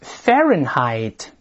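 "Fahrenheit" very slowly, FAIR-en-hite, falling; slow model reading. Shown as a demonstration. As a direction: drag out "Fahrenheit" for students. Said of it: "再来一遍". (再慢读一遍"Fahrenheit")

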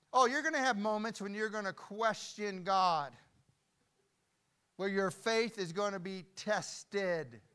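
loud, high, impassioned proclamation; full-force preaching. Real, not acted. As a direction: cry it out over the congregation.

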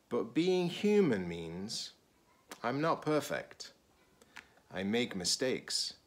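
expressive picture-book read-aloud; reading to children. Acted. Teacher reading a picture book to students, expressive read-aloud. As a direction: read it slowly to a class of children.

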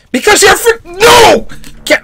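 high-pitched outcry; protesting cry. Real, not acted. Viewer protesting at the screen, high cry.

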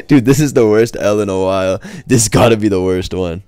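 loud, amused disbelief; real, loud dismay at a bad loss; loud exasperation. Real, not acted. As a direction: exclaim it loudly, fresh off a laugh.

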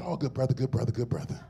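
a quiet, amused echo of West's greeting; imitating Doctor West. Cornel West answering Pastor Mike about the police, low and soft. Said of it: "I hope I don't butcher the line".

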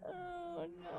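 yawning mid-word; soft yawned words. Real, not acted. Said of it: "I can't stop yawning".

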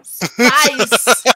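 a loud, high, goofy yell of the word; loud silly yell. Acted. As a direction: bellow it like a cartoon. Copying silly voice.

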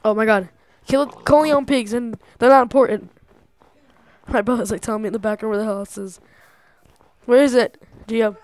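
normal talking voice

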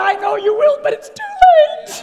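very high, loud wail, slowing down; mock crying father. Father answering his pleading daughter, exaggerated high tearful wail. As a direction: wail it out in hammed-up tears. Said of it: "then I'm starting to cry".

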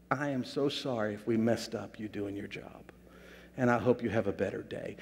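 soft, low, sincere apology; quiet, heartfelt retold apology; gentle apology. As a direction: apologize tenderly, keeping it low.

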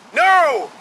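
high, loud refusal; shout. A narrator's reading. Captain refusing the passenger's box, shouting. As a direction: shout him down in one burst. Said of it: "shouted the captain".

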